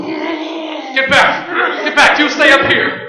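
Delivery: loud, high commands; shouting. Acted. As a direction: yell them back. Shouting at zombies.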